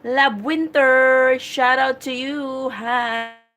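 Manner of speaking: loud, drawn-out shout-out; calling out a greeting. Real, not acted. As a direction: greet a live viewer loudly, stretching the words. Greeting a viewer on live stream. (malakas at pahabang pagbati)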